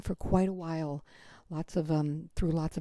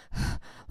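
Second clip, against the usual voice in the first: winded gasp, imitated; out-of-breath panting; 5 dB quieter than usual. Shown as a demonstration. Runner bursting into the pub, acting out of breath. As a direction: pant as if you just ran in. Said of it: "like that, like out of breath".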